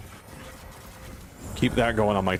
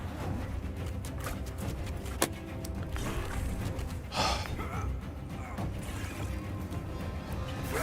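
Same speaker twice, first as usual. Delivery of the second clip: long heavy sigh; 12 dB quieter than usual. Real, not acted. Gamer after a failed attempt.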